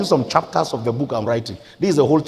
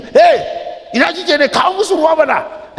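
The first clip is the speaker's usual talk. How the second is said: high, loud yell at wife; retold shout; 7 dB louder, slower than usual. Husband shouts at his wife before guest pastors, loudly. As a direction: call out sharply, voice thrown right up. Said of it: "And I shouted".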